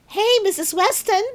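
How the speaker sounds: high call across the room; boy tattling to the teacher. Acted. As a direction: call the teacher over gleefully. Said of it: "he called".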